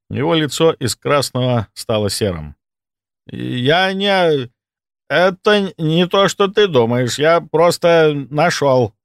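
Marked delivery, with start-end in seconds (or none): 3.3-8.9 higher, halting protest of someone caught; flustered excuse. Acted. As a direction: stammer out a mild panicked denial. Caught thief stammers excuses.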